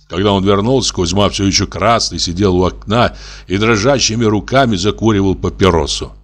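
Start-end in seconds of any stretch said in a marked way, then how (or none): none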